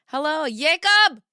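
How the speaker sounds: high, loud, slow drawn-out hello; mock grand greeting. Greeting a chatter named Jacob with hammed-up flair. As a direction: stretch the greeting like a game-show host.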